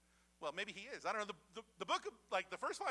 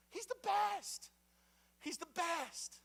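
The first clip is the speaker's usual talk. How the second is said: much higher, slow sing-song he's the best; high mock praise; 11 semitones higher, 5 dB louder, much slower than usual. Preacher mimicking God boasting of Moses, high and slow. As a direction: gush it slowly, up high, half teasing. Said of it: "mocking God's praise".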